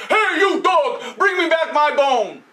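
shouting butcher; angry butcher. Acted. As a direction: demand the bone back.

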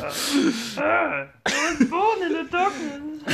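slow, high, hammed-up Bane voice; Bane impression. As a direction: intone it like a movie villain. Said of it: "That's Bane".

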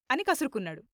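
usual speaking voice

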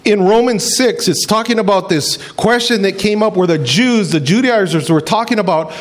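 ordinary talk

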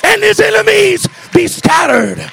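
high, loud, slow climactic shouting; preaching at full force. Acted. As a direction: roar it out to the congregation. Preacher at peak intensity.

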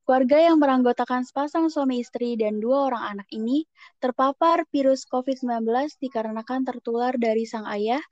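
formal newscaster delivery; news read. Acted. Reads a news item.

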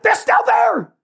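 high laughing shouts, giddy and loud; hammed-up frantic Peter. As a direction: yell orders through laughter. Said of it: "You know what I mean?".

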